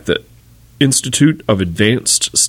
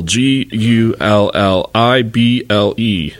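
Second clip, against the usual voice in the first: slow, letter-by-letter spelling; spelling the word for listeners to look up, slowly; spelled out slowly; much slower than usual. Shown as a demonstration. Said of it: "look up gullible".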